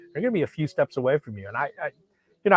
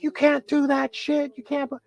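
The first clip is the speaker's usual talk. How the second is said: retold yelling. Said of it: "She starts yelling at the guy".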